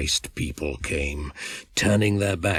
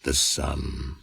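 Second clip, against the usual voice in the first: usual speaking voice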